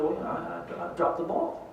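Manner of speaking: very soft, hesitant mumble; small-voiced imitation of an embarrassed player; sheepish. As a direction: admit it sheepishly, barely audible. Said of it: "looks at me a little sheepishly".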